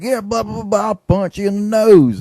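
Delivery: put-on a playful old-timer's threat; old Southern man threat. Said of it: "old Southern man threat".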